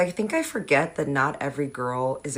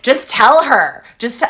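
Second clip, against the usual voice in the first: impatient urging; 6 semitones higher, 10 dB louder, slower than usual. Retold or imitated. Boyfriend urging his girlfriend.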